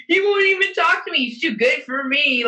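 high, mock-wounded taunt; drunk teasing. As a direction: needle someone like a tipsy pest. Drunk self teasing the biker.

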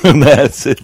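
ordinary talk, nothing marked

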